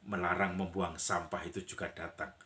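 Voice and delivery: ordinary delivery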